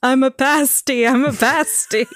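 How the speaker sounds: a hint of Oswald insisting he's just a patsy; Oswald patsy joke. Cohost joking to the host as Oswald, mock indignant protest. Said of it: "Lee Harvey Oswald humor".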